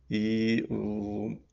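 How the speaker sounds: ordinary talk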